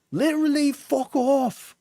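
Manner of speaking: very high, squawky mock Cockney; attempted Cockney. Streamer acting out a partner's insults, mock Cockney. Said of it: "It's Cockney, I think".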